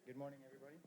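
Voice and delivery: mumbled greeting, barely heard; quiet. Shown as a demonstration. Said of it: "Let's try that again".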